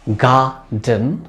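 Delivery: Garden in two slow beats, "gar" and "den"; syllable by syllable. Shown as a demonstration. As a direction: break garden apart with a pause between syllables. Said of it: "दो syllable के भी word".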